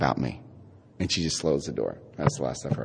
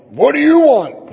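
a higher, louder, slowed mock bark from the sergeant; big sergeant; 12 semitones higher, 4 dB louder than usual. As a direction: play the sergeant demanding at the desk, high and slow. Gruff sergeant at the desk.

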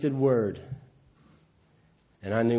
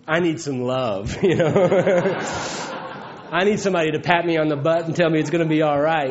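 laughing, fast and loud; words tumbling out with real laughter; laughing through it; 4 semitones higher, 4 dB louder than usual. Real, not acted. Telling the crowd his need, laughing hard.

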